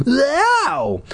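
high, drawn-out "ow"; yelp of pain. A narrator's reading. Boy scratched across the arm by cat, pained yelp.

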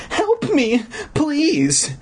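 high-pitched acted plea for help; terrified cry. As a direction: cry out high like a frightened little girl. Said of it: "the girl's terrified cries".

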